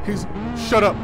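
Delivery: ordinary talk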